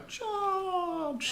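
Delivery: high reedy battle cry; reedy voice carried on the wind; thin reedy voice. Acted. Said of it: "his reedy voice kind of on the wing go".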